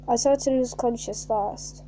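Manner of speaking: plain reading voice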